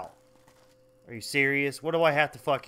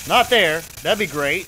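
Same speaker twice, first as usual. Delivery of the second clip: annoyed outburst; 2 semitones higher, 8 dB louder than usual. Real, not acted. Gamer frustrated at game.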